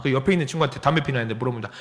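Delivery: ordinary talk, nothing marked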